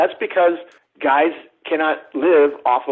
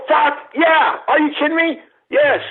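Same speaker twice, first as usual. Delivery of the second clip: much higher, overjoyed whoop; thrilled yell; 12 semitones higher, slower than usual. Retold or imitated. Young [name] accepting free Kiss tickets, thrilled. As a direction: explode with delight.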